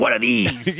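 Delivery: playful imitation of a pumped-up wrestler; Macho Man Randy Savage. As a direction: lightly ham up a wrestler grabbing your arms. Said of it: "like the Macho Man Randy Savage".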